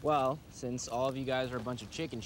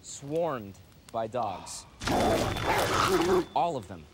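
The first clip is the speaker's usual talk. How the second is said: slow, drawn-out grim reveal; grave storytelling. Acted. Man ending a tale of deadly dogs for friends, grave and slow. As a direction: let each phrase land with long pauses.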